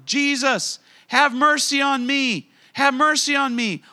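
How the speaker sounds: loud, high-pitched call for mercy; yelling out. Acted. Blind man calling to Jesus for help, yelling. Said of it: "he started yelling".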